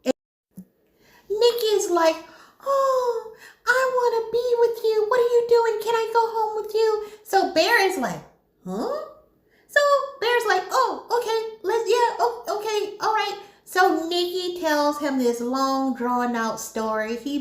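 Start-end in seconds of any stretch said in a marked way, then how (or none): 2.6-7.0 high-pitched mock infatuation; clingy love-struck girl. Nikki begging Bear to go home with him, clingy and high. 8.7-9.1 high, soft questioning "huh"; baffled. Retold or imitated. Bear reacting to Nikki's sudden interest, baffled. 10.7-13.4 high, halting mock acceptance; flustered agreement. Bear agreeing to take Nikki home, flustered and high.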